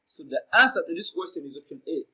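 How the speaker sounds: usual speaking voice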